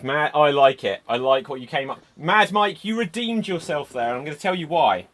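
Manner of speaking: usual speaking voice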